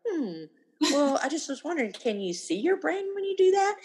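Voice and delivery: musing, rising high into the question; innocent wondering. Shown as a demonstration. Parent teasing eye-rolling kids, innocently wondering. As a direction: feign puzzled curiosity. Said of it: "just say it like I said it".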